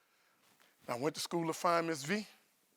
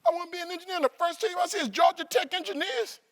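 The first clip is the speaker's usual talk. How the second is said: much higher eager voice; thrilled as if fate stepped in; excited; 14 semitones higher than usual. Retold or imitated. Excited inner thought.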